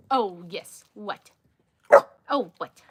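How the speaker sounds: normal talking voice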